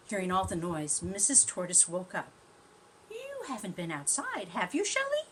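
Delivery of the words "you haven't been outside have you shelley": suspicious mother. Acted. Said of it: "she asked".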